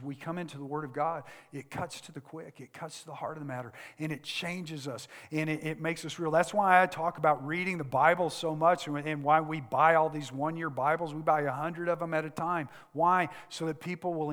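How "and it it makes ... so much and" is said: ordinary delivery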